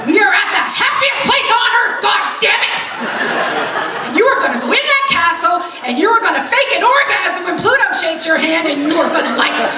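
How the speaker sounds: shouting high and loud, laughing through it; exasperated wife shouting her husband down with a laugh; mock yell. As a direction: yell it at him like a furious drill sergeant. Yelling at husband about Disney.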